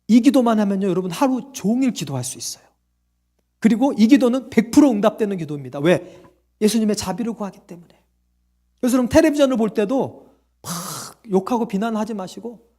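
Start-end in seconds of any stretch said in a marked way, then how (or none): none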